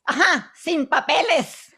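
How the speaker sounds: loud, slow mock yell; yelled punchline. Pancho yelling out the punchline, loud and slow. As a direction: belt it out like a heckler. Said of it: "Pancho yells out".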